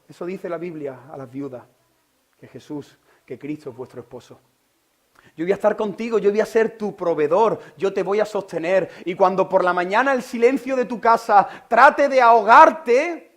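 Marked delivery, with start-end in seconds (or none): 5.4-13.3 louder and higher, slowing at the end; loud promise. Acted. Jesus promising the widow he will stay, ringing out. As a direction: proclaim it as a vow to the widow. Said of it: "Jesús le está diciendo".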